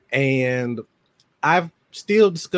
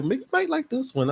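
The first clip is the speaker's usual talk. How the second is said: imitated librarian; 12 semitones higher, 4 dB quieter than usual. Voices the librarian's suggestion.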